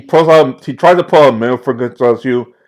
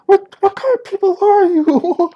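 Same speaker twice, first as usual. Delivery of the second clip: high frightened thug. Acted. Captive horrified by torture.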